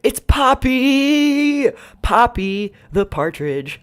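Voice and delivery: high, loud, slow drawn-out reveal; excited announcement. Acted. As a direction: announce her like a game-show star. Announcing the next character reveal.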